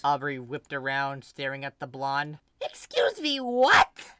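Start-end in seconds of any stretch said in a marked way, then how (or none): 2.6-4.2 much higher, loud incredulous squawk; a much higher, louder "what?" of shock; shocked disbelief. Acted. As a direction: react as if you misheard something outrageous. Aubrey shocked by the reveal.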